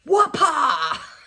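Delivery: loud cheer; excited shout. Real, not acted. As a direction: whoop it out happily. Celebrating finished progress.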